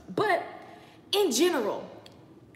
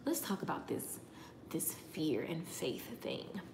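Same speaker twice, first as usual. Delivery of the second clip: slow breathy whisper, drawing them in; dramatic whisper; 6 semitones lower, 13 dB quieter than usual. Real, not acted. Host whispering the episode topic to viewers, slow hush. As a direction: lean in and whisper the topic slowly.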